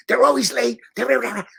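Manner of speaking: whining to his wife about the actors; bitching and whining. Retold or imitated. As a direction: moan like a spoiled star. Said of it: "bitching to my wife".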